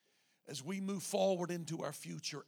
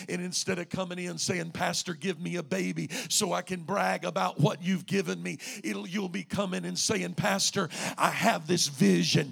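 rising from firm to very loud; building preaching; 3 semitones higher, 15 dB louder than usual. Real, not acted. Pastor urging members to come with vision, building loud preaching. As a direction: drive the exhortation up to full force.

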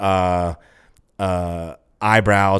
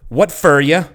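loud, high Southern "What fur ya?"; curt Appalachian twang, pitched high; Appalachian diner waitress; 8 semitones higher, 9 dB louder, slower than usual. Retold or imitated. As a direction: bark it out in a backwoods Southern accent. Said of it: "first words out of her mouth are".

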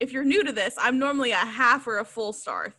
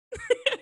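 high giggle; 13 semitones higher than usual. Real, not acted. Reacting to co-host's joke.

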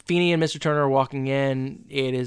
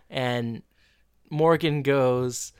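usual speaking voice

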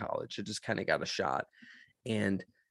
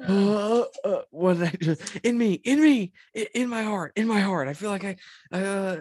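flustered panic; 11 semitones higher, 5 dB louder than usual. Retold or imitated. Flustered retelling of slip.

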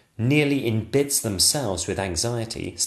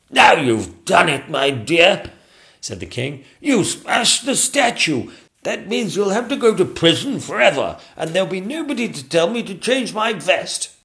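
pompous king; 10 semitones higher, 7 dB louder than usual. Acted. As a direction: scold the queen with glee. King scolding Queen.

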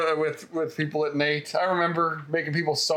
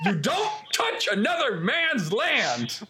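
higher, slow and emphatic; mock stern warning; 6 semitones higher, slower than usual. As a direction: lay down the law word by word, playfully. Mock-solemn warning to opponent.

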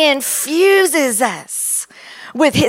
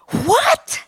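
higher, startled disbelief, a mock gasp of a question; astonished what; 6 semitones higher than usual. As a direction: recall the jolt of discovery. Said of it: "And I'm like".

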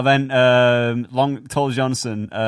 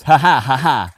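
[name] laugh; 5 dB louder than usual. Retold or imitated. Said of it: "his little callous laugh he does in his raps".

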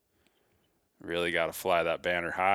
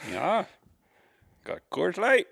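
mock Canadian accent, much higher and louder; 13 semitones higher, 5 dB louder than usual. Host plays along with cohost's bit, high mock Canadian accent. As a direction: answer back in a hammed-up Canadian voice, pitched way up. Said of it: "then I can talk in an accent".

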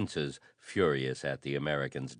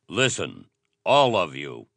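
firm, loud call for attention; forceful order, building in volume; authoritative shout; 7 dB louder than usual. A narrator's reading. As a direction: command the whole room to listen. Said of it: "he shouted in deep tones that were strangely authoritative".

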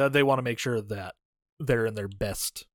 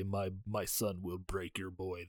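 Drago quoted; 6 semitones lower, 14 dB quieter, slower than usual. Retold or imitated. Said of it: "come back and say".

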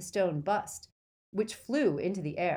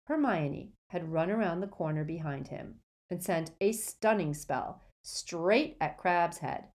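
usual speaking voice

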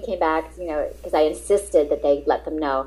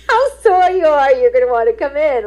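laughing retelling; 9 semitones higher, 6 dB louder than usual. Retold or imitated. Manager's message, she laughs.

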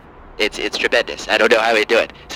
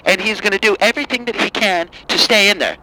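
higher, whiny outraged liberal; angry liberal caller; 6 semitones higher than usual. Acted. Caller ranting at the host in a higher outraged voice. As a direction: rant like a fed-up caller. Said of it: "Hi, Angry Lib".

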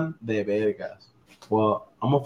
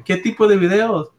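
grandma; 9 semitones higher than usual. Acted. Grandma continues.

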